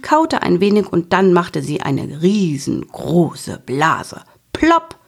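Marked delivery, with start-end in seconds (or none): 4.5-5.0 high popping plopp. A narrator's reading. Bubblegum bubble bursts.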